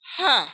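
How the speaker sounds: हा breathed out from the chest; हा as a breathy push of air. Shown as a demonstration. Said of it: "सीने से आवाज निकलेगी". (सीने से हा)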